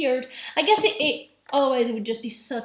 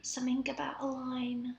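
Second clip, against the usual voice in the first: whispering; 7 dB quieter than usual. Real, not acted. Continuing secret whispered exchange.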